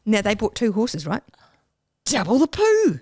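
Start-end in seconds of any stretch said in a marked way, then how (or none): 2.1-3.0 gleeful punchline. Real, not acted.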